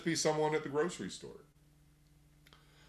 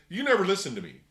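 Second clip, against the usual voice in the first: higher and louder, accusing wife; mock angry wife; 7 semitones higher, 5 dB louder, faster than usual. Wife who turned off the TV accuses her husband, higher and louder. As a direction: scold him for never listening. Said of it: "and says".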